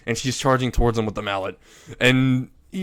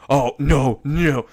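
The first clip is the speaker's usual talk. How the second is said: flustered denial; 3 semitones higher, 6 dB louder, slower than usual. Acted. Priest flustered by the joke.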